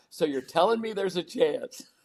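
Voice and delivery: slow, lightly voiced hopeful movie line; movie line quote. Speaker quotes Dumb and Dumber, slow hopeful movie voice. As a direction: quote it with naive hope, slow. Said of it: "one of the greatest movie lines".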